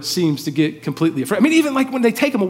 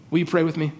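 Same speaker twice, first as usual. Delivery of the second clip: ordinary delivery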